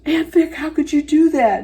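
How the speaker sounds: high, loud mock shock; scandalized. Niece scolding her aunt in retelling, scandalized and high-pitched. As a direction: act out an aghast reproach.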